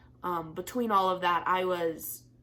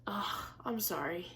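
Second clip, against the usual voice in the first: ordinary delivery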